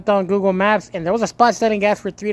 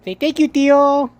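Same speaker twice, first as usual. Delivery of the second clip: put-on higher, slower coaxing voice, like talking to a toddler; 7 semitones higher, 3 dB louder, slower than usual. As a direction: coax the pet to say thank you. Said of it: "I'm talking to you".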